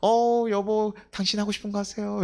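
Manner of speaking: high, beaming voice to the wife; a husband coming home from church sweetly tells his wife to do as she likes; bright and sweet. Pretend. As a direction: brighten up and sound generous. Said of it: "얼굴 환해져가지고".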